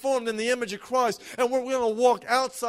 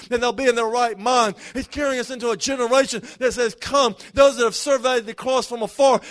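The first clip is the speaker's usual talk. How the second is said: loud, rising preacher's cadence, driving each line home; preaching at full force; 6 dB louder than usual. Acted. Pastor urging the standing church to receive, preached full force. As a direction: pour it out over the congregation like a revival call.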